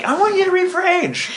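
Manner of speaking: high, bright impression of the director Joel; a quick, playful Joel impression; imitating Joel. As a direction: put on Joel's voice for the casting ask. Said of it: "He does a good Joel".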